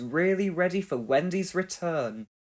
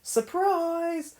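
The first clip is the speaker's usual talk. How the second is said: excited shout; 13 semitones higher than usual. A narrator's reading. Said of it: "shouted the team".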